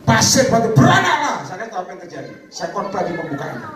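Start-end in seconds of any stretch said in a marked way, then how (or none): 0.1-1.4 high, loud command; commanding faith declaration. Retold or imitated. Man orders sand and stone to multiply, loud high command. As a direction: proclaim it forcefully, pitch leaping up. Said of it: "Terus dia ngomong gini".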